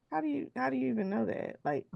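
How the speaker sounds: high, startled disbelief; mock bewildered reply; retold shock. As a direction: act caught off guard, voice jumping up. Her shocked reply to a coworker.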